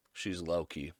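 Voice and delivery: ordinary talk, nothing marked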